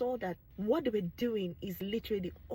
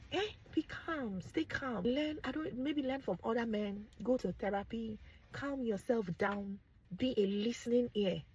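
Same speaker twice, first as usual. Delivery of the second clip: ordinary talk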